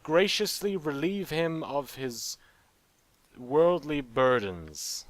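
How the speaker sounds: slow, lofty, tongue-in-cheek phrasing; mock-grand euphemism. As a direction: dress up theft as a noble deed. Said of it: "I mean".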